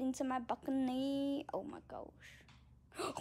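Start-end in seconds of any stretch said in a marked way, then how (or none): 1.5-3.2 breathless whisper; whispered gasp. Real, not acted. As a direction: whisper it in shock. Startled, hushed.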